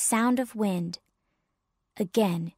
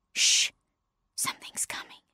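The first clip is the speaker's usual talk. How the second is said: whispered warning; shush, then barely whisper; very soft, voiceless alert that danger is near; urgent hush; 4 dB quieter than usual. Acted. Papa warns of approaching danger.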